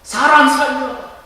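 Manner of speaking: high, loud drawn-out shout; acted cry for help. Drowning person calls to anyone for rescue, loud acted shout. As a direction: call out urgently as if drowning. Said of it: "물에 빠져 죽을 위기 가운데서".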